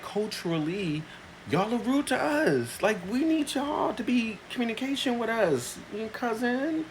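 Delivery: pointed explanation; lecturing. Retold or imitated. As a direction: spell it out like a patient lesson. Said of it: "I'm like".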